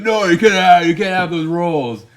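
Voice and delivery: loud high no from the agent; agent shouting no. Retold or imitated. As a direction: shout it down like a gatekeeper. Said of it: "He was like".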